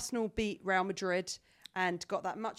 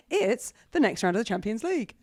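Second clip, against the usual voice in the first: ordinary delivery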